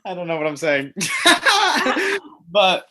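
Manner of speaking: high, loud, breaking into laughter; real amused laughing. Real, not acted. Guest laughs at her own joke, high giggly delivery. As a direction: say it while cracking up.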